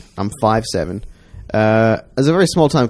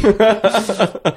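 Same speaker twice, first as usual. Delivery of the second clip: pitched-up granny laugh; high old lady cackle; 9 semitones higher than usual. Retold or imitated. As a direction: cackle like a giddy old woman. Old woman laughing in trailer.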